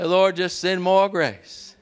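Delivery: slow, loud pleading to the Lord; a slow, loud prayer led for the church; prayer cry. Acted. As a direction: cry out for grace.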